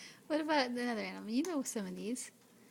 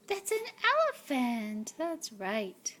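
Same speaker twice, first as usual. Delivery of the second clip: put-on reading to a toddler; 6 dB louder than usual. Dad reading picture book to toddler.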